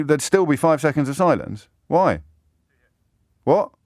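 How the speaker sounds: normal talking voice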